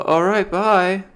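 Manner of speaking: high, slow, drawn-out bye; awkward HR lady. Retold or imitated. HR lady as he offers a handshake, slow awkward bye. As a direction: stretch the goodbye, unsure what to do.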